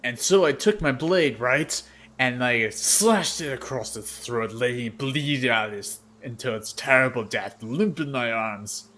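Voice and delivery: loud, slow bragging war story; a swaggering warrior hamming up a bloody tale; boastful warrior. Acted. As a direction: boom it out like a proud fighter reliving a kill. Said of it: "the Earth Genasi in the middle says".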